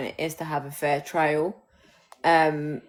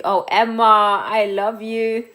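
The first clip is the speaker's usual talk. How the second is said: gushing; 3 semitones higher, 8 dB louder, slower than usual. Real, not acted. Gushing at co-host Emma.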